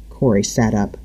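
usual speaking voice

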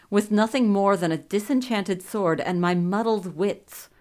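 usual speaking voice

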